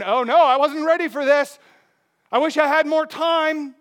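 high, loud panicked regret; mock dismay. Preacher playing someone unready for Christ's return, mock dismay. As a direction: cry out in hammed-up alarm.

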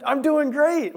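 high, bright fake I'm fine; forced cheery high answer; fake cheerful. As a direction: chirp it up high to hide the stress. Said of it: "like that".

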